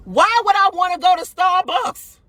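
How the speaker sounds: high, exclaimed rhetorical question. Real, not acted. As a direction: cry it out in disbelief. Repeats question in indignation.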